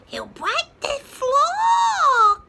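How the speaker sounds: high, loud, slow squawk; small chicken wailing; clucking chicken. Acted. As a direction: wail it like a panicky hen. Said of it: "clucked the smaller chicken".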